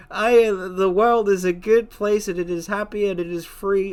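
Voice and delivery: a mock-innocent happy bird; sweet naive voice. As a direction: gush like everything is lovely. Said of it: "Blue versus".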